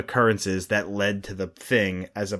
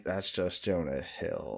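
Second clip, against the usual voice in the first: dismissive quote; 6 semitones lower, 14 dB quieter, slower than usual. Retold or imitated. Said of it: "been like".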